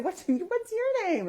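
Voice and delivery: very high, slow coaxing question; talking to small child. Retold or imitated. Adult asks three-year-old a name, child-directed voice. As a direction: draw out a shy toddler, high and slow.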